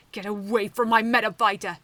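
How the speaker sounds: loud furious shout to defend him; fierce protective shout. Acted. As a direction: yell it out, lunging to his aid. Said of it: "she shouted".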